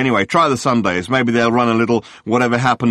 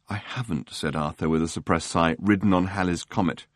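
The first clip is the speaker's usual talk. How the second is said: weary Arthur; 2 semitones lower, 8 dB quieter than usual. Acted. Arthur brushing off the journalist, much softer. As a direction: mumble it off, wanting no part of it.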